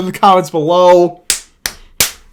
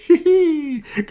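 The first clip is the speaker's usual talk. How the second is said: laugh; 11 semitones higher than usual. Real, not acted. Laughing after his cheer.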